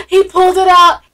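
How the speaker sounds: normal talking voice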